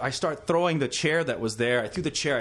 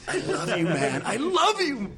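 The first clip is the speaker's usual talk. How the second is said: gushing; 6 semitones higher than usual. Retold or imitated. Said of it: "he's like".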